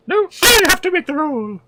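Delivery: high squeaky I have to make the roll; squeaky joke voice. Pretend. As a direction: pitch I up into a silly squeak. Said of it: "repeated with different voice".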